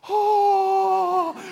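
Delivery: long, high, loud "oh"; thrilled gasping cry. Retold or imitated. Preacher voicing his inner thrill at the video, high cry. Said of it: "something inside of me just goes".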